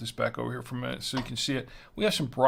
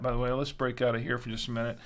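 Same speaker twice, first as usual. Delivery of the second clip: usual speaking voice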